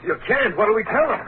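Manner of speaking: high, panicky pleading; desperate. Acted. Husband begging the robber not to take the cash. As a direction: voice climbing with desperation. Robber taking their money.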